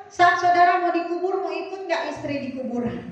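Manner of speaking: ordinary delivery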